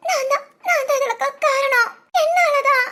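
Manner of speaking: sobbing self-blame; crying hard. Acted. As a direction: blame yourself through heaving sobs. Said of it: "அவ அந்த அழுக அழுகுறா". (கதறி அழுதபடி)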